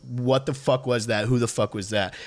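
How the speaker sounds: ordinary delivery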